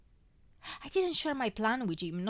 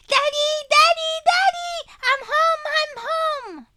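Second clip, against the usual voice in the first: put-on very high, loud toddler squeal; a tiny girl shrieking for her daddy; shrill little girl calling; 13 dB louder than usual. A narrator's reading. Little daughter running home to her father, shrilling excitedly. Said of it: "shrilling at the top of her tiny lungs".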